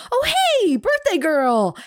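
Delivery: high, bright mock greeting from a stranger; cheerful greeting. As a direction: sing it out high to the birthday girl. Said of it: "And he went".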